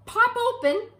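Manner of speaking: high, loud burst of delight; excited climax. A narrator's reading. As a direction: make them pop, bright and loud.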